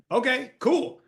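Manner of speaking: high, offhand mock player voice; imitating the player. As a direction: toss it off like a laid-back teen. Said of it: "it was like".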